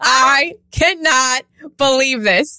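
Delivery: drawn-out, very slow mock disbelief; very slow hammed-up disbelief. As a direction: stretch every word in dismay. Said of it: "I'm like".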